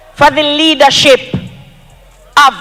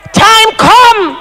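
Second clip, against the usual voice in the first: rising rally call; 4 semitones higher than usual. Acted. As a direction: call "Time come" out to the crowd, strong and high. Rally chant, repeated slogan.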